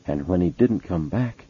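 usual speaking voice

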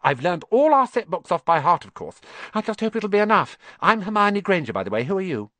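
put-on high, fast, know-it-all girl; very fast, bossy. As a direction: rattle it off without pausing for breath. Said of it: "She said all this very fast".

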